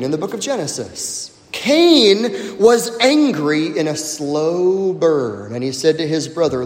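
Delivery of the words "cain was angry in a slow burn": ordinary talk, nothing marked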